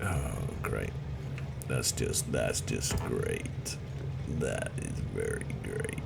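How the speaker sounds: deep, slow sarcastic voice; sarcastic low drone. Acted. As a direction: drop the pitch way down and deadpan the praise.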